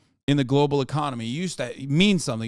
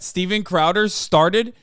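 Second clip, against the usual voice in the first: ordinary talk, nothing marked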